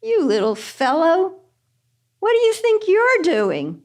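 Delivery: high-pitched mock scolding; playfully incredulous, swooping high; mocking amusement. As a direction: tease a tiny upstart, pitched well up. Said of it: "started laughing and going".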